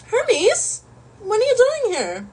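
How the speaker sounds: very high, mock-indignant demand; shrieky, exaggerated "why are you here" reaction; rude greeting. This is really rude.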